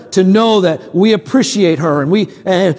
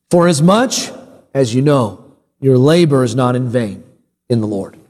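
deliberate scripture reading, pausing after each phrase; low, measured, letting each phrase land; slow careful reading; 3 semitones lower than usual. Real, not acted. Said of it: "read this slowly and carefully".

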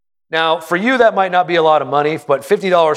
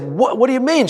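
retold surprise; 8 semitones higher than usual. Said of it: "I said".

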